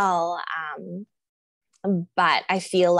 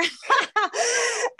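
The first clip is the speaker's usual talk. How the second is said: real laugh. Real, not acted. Yoga student laughing at being the worst in class, high laugh.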